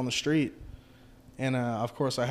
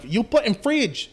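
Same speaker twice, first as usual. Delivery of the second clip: higher, slow broken-English order; mock angry owner; 7 semitones higher, slower than usual. Retelling the owner's angry order, slow mock accent. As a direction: stretch out the irritated demand. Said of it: "She was, like, pissed".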